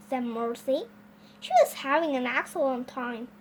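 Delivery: plain reading voice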